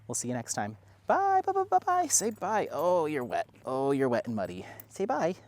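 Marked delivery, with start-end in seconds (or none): 1.0-2.7 very high sing-song byes. Real, not acted. Waving bye with the dog. 2.7-5.3 talking to dog. Real, not acted.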